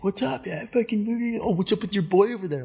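retold greeting pitched far above normal; high-pitched mimicry. Andy needling a friend about his boy, high mimicked voice. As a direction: imitate a surfer in a high, keyed-up voice. Acted quote of Andy's greeting.

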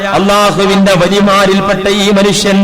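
loud, ringing sermon voice; preaching at full force. Acted. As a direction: proclaim the story to the hall. Loud sermon narration of weeping saint. (ഉച്ചത്തിലുള്ള പ്രസംഗ ശൈലി)

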